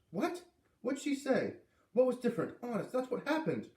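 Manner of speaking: put-on high, urgent girl's protest; a kid pleading her case, voice up high. Girl protesting to Dad.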